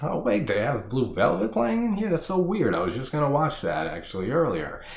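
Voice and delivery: high, puzzled rush of disbelief; high-pitched mock puzzlement; baffled surprise. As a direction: replay the moment of confusion. Retold confused reaction.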